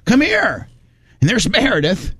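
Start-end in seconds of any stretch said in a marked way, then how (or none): 0.0-0.7 high, summoning call. Retold or imitated. Mom summoning Doug from afar, calling out. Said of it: "She goes".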